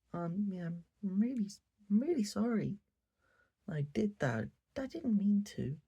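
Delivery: high, dismissive mock-sorry voice; insincere apology. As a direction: toss off a fake apology like a bratty girl. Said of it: "the most bitch-ass apology".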